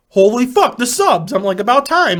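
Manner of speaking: loud, high exclamation; mock amazement. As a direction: act out suddenly remembering a forgotten delivery. Retold reaction to late delivery.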